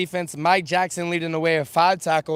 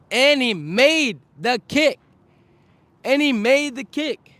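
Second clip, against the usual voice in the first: rising, louder, excited call of the kick; 7 semitones higher, 4 dB louder than usual. Real, not acted. Sports vlogger to viewers, excitedly calling the kick. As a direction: hype up the game-winning kick.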